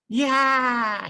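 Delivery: high, loud joyful shout; excited yeah. Acted. Bear rolls in the waves and cheers excitedly.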